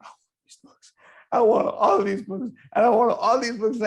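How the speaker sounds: whispered sob rising into a high wailing plea; imitating her crying. Retelling the woman who wept over the books, tearful high wail. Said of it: "she just started crying and crying".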